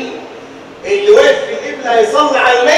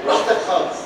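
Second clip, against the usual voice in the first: whispered, lower jab; a soft, lower mock shrug of a line; whispered taunt; 7 semitones lower, 7 dB quieter, faster than usual. Real, not acted. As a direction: whisper it as a dry dare. Preacher taunts the debtor.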